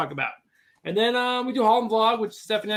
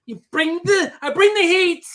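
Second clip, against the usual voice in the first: hype shout; 9 semitones higher, 7 dB louder than usual. Acted. Hype boast, loud high.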